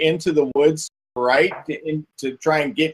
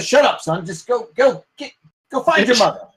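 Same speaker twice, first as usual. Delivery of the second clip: a hint of a sharp father, higher; father hushing son; 6 semitones higher than usual. Villager hushing his son in front of the stranger, a hint sharp. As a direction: cut the kid off quickly.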